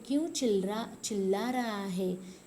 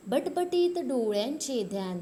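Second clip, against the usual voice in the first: loud, higher recital opening a verse; poem recitation; 4 semitones higher, 4 dB louder than usual. Acted. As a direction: boom out the first line of the stanza. Teacher starts new stanza.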